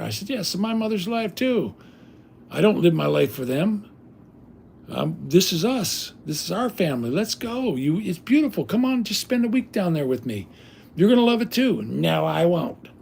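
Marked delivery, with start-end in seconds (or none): 0.3-11.8 eager pleading. Retold or imitated. Pleading with wife to move. 11.8-12.9 slow, nasty, flat-out no; imitating the wife's nasty slow refusal. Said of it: "Just nasty as could be".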